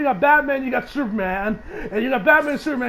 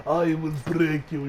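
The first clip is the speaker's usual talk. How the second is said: put-on Russian accent; 5 semitones lower, 4 dB quieter, slower than usual. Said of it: "He kinda sounded like, Dragunov, from Rocky Four".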